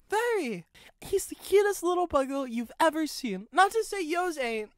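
proud Chuck. A narrator's reading. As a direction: lift the pitch, proud of the baby. Chuck voiced in dialogue.

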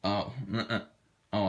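normal talking voice

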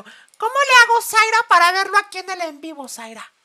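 high, loud call across the room; calling out to a friend in another room. Real, not acted. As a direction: shout for Zaira from afar. Calling out to Zaira.

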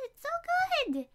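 high, slow cooing "so good". Pretend. Cooing repeat.

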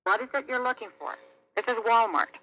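ordinary delivery

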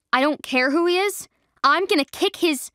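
furious shout. Acted. Juniper shouts threats against Trinity's mate. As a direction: shout it, ready to fight. Friend raging after hearing insult.

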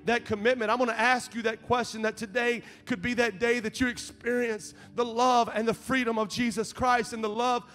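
loud, high, impassioned preaching; rising pastor's appeal; preaching at full force. Acted. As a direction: lift the room with fervent preaching. Preaching climax, raised voice.

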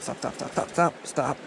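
normal talking voice

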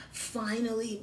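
usual speaking voice

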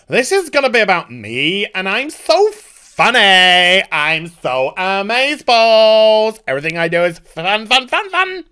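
preen like a smug showman; mock narcissist. Mocking the creator, a smug, gushing voice. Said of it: "appears to be a narcissist".

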